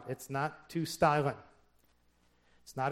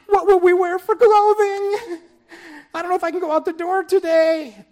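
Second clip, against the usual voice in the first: mock worrier; 12 dB louder than usual. Preacher mocking worriers fretting about food and clothes, high fretful wail. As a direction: play the anxious worrier crying out over food and clothes.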